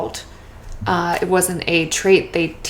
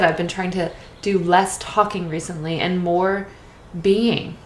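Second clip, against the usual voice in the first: ordinary talk, nothing marked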